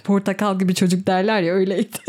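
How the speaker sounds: giggling through the words; laughing. Real, not acted. Recalling her first crush, laughing warmly. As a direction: laugh fondly at the memory.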